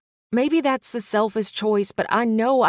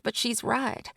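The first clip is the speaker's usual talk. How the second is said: usual speaking voice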